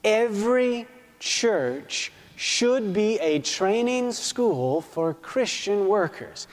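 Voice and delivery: very slow, deliberate reading; slow emphatic reading. Real, not acted. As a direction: spell out each phrase for the congregation. Rereads key sentence for emphasis.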